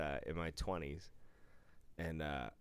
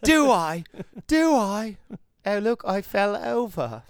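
much higher, louder Austin Powers voice; 14 dB louder than usual. Retold or imitated. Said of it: "I have to do Austin Powers".

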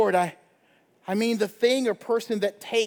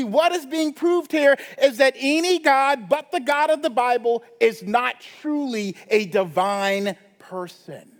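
normal talking voice

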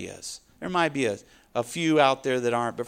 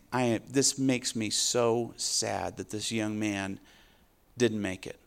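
a touch of heartbroken God, softer; God sorrowing; 3 semitones lower, 7 dB quieter than usual. Retold or imitated. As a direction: let the grief sit quietly. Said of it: "God's saying".

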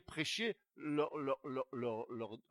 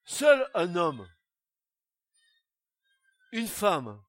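fiery preaching; 4 semitones higher, 8 dB louder than usual. Acted. Preacher urging the congregation toward Jesus, loud fiery preaching. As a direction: proclaim it to the whole church.

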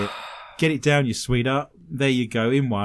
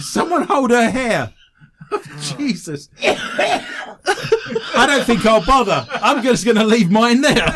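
laughing; 7 semitones higher, 3 dB louder, slower than usual. Real, not acted. Still laughing.

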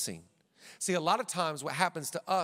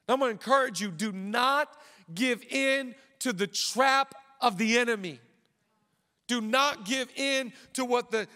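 louder preaching, rising to the crowd; full-force preaching; 4 semitones higher, 6 dB louder than usual. Acted. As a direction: preach it out to the congregation.

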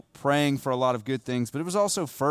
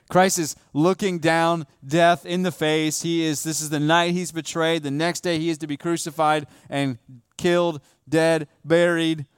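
louder, higher, slowing to dead, buried; fervent preaching; 4 semitones higher, 4 dB louder than usual. Acted. Pastor to church about the night of betrayal, rising fervent preaching. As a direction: press the weight of the coming death on them.

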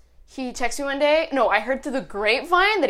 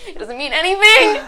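loud, high, laughing through the words; 5 semitones higher, 8 dB louder than usual. Real, not acted. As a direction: splutter it out through real laughter. Laughing fit over an ambiguous word.

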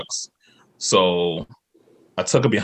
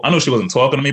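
ordinary delivery

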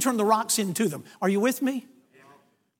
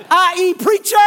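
loud, high proclamation; punchy raised-voice declaration; emphatic announcement; 7 semitones higher, 7 dB louder than usual. Retold or imitated. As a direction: drive the reveal home at full voice. Preacher drives the reveal home.

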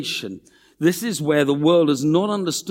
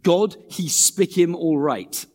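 slow, lightly voiced Pacific pidgin English; a hint of Pacific pidgin, savoured slowly; 3 semitones higher, 4 dB louder, slower than usual. Shown as a demonstration. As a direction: read it out in Pacific pidgin, unhurried. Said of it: "beautiful pidgin translation".

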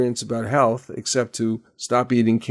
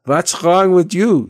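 put-on slow, high German-accented question; 7 semitones higher, 6 dB louder, slower than usual. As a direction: imitate a German lady asking, loud and slow. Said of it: "a lady with a thick German accent".

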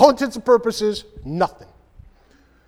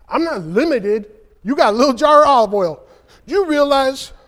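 higher and louder, insisting; emphatic preaching; 6 semitones higher, 7 dB louder than usual. Real, not acted. Preacher insisting to the congregation, louder. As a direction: drive the point home.

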